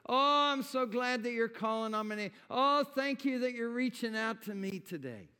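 the Lord rejoicing over his people, joyful; God rejoicing. Acted. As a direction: burst out with delight over them. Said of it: "That's what he's saying".

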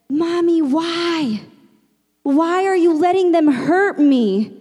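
drawn-out, high-pitched imitation of a tearful child; crying toddler. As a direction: plead like a hurt child asking mom why.

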